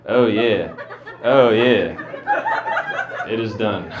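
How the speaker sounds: hammed-up deadpan lover; mock passion with no excitement in it; unenthusiastic bedroom talk. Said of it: "You don't sound like you're into this".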